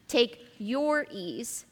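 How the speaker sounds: "your" stretched out long; slow right down and dwell on "your"; stress on YOUR. Shown as a demonstration. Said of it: "emphasize the words that I would mark".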